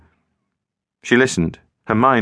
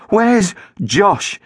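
demanding again; 11 semitones higher than usual. A narrator's reading. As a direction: press the question hard. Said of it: "she said again".

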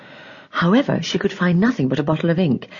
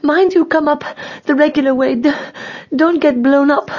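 high, halting queen voice; out of breath; 10 semitones higher than usual. A narrator's reading. Breathless queen warning the king, high and halting. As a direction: warn in high, breathless bursts. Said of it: "who was still a little out of breath".

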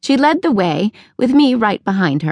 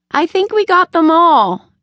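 put-on boyish, much higher and a bit louder, pleased with himself; grinning boy; 10 semitones higher, 4 dB louder than usual. A narrator's reading. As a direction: say it with a proud grin. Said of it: "Billy grinned".

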